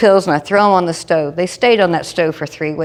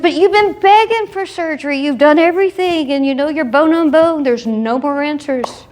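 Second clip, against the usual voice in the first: exasperated surgeon; 8 semitones higher than usual. Retold or imitated. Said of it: "he said".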